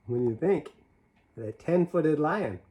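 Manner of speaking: ordinary delivery, nothing marked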